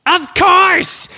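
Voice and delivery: very high eager cartoon reply; high character voice. Dad answering about pizza bagels, high cartoon voice. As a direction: squeak out the answer.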